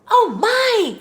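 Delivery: high, loud startled cry; frightened cry. A narrator's reading. Goldilocks wakes to three bears over her, frightened cry. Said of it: "cried Goldilocks. Frightened".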